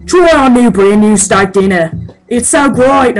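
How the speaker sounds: loud, hammed-up Aussie ad man; thick Australian accent. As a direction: belt it out in an exaggerated Australian accent. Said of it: "super thick accent man".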